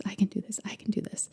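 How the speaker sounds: whispered self-encouragement, fading; hushed repetition. Retold or imitated. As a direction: whisper it like a private mantra. Repeating a calming phrase to herself.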